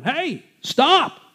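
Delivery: high yelled call to stop; hollering. Retold or imitated. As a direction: shout after them to halt. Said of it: "they're hollering at him".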